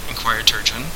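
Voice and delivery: usual speaking voice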